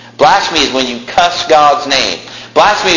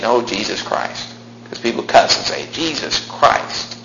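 hushed quote; 2 semitones lower, 7 dB quieter than usual. Retold or imitated. Quiet mock of cussing.

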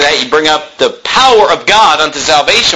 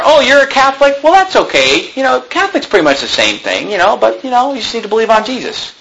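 higher-pitched, sugary parody of tolerance; mock reassurance; 6 semitones higher than usual. Parodies Jesus being lenient to Catholics.

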